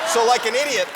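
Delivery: usual speaking voice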